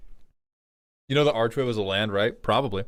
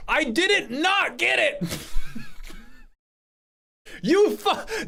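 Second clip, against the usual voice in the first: higher, slower, hammed-up protest; mock indignant outburst; slower than usual. Streamer mock-protesting to chat that he got the Wario joke. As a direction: play up being offended by the joke.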